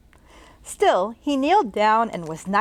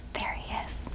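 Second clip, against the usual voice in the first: put-on hushed whisper to the children; 14 dB quieter than usual. Reader whispering to young listeners where the ghost hides. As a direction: whisper it like sharing a secret.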